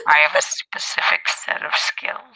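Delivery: breathy menacing movie whisper; Liam Neeson impression. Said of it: "Liam Neeson".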